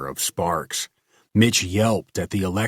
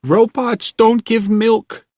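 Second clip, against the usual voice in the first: frantic cry; 8 dB louder than usual. A narrator's reading. Frantic girl continues.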